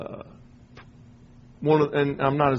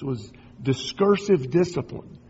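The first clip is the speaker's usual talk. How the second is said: usual speaking voice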